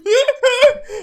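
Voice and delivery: high burst of laughter; loud laugh. Real, not acted. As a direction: cackle out loud.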